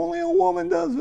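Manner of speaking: high, tearful voice breaking; crying out the words through tears; choked-up crying. Retold or imitated. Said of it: "I'm just, like, bawling".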